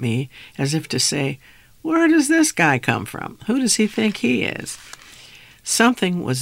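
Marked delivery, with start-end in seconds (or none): 1.8-4.8 mock-sneering, pitched much higher; scornful bystanders. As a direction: sneer it like snooty onlookers. Imagined people sneering at him.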